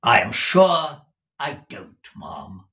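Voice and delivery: loud, slow, dropping on the last word; curt, heavy disclaimer; gruff Ralph Nickleby. Acted. Ralph assures madam he ignores her husband, gruff.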